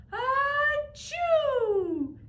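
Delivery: huge, high, loud sneeze; big sneeze. Acted. Bear sneezing from pepper, loud. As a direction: let the bear's sneeze burst out.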